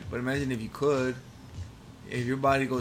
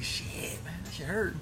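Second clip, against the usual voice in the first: whispered; 5 semitones higher, 5 dB quieter, slower than usual. Retold or imitated. Retelling his pain, whispered.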